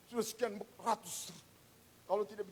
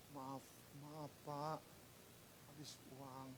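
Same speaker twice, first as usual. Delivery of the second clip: very soft, low, halting plea; hushed, deferential apology with pauses; acted humble apology; 7 semitones lower, 18 dB quieter than usual. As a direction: play a debtor timidly asking for time. Acts debtor humbly begging for time.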